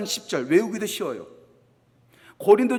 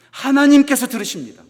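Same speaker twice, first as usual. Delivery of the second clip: loud proclamation; 3 semitones higher, 6 dB louder than usual. Shown as a demonstration. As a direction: proclaim it to the whole church. Repeated line, whispered then loud. (크게 힘주어 선포하듯)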